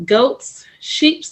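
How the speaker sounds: ordinary delivery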